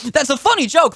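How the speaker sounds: high, exaggerated sarcastic "funny"; mock admiration, stretching the word "funny" up high; sarcastic praise. Real, not acted. Host mocking the staffer joke, sarcastic and high.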